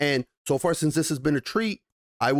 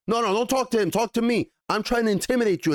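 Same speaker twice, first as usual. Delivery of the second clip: pushy thug; 9 semitones higher than usual. Acted. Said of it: "Guy One is like".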